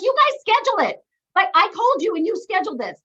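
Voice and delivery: the protest she kept in her head; inner indignation. Retold or imitated. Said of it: "this is all going in my mind".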